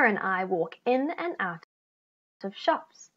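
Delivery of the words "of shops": ordinary delivery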